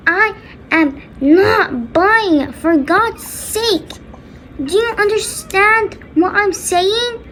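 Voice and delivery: high, slow, emphatic refusal; acted parent losing patience; exasperated scolding. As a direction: spell it out in outrage, word by word.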